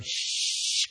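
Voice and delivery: long imitated burner hiss; stronger gas hiss. Potter turning kiln gas up further, strong hiss. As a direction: hiss like gas turned well up. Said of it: "then you turn it up".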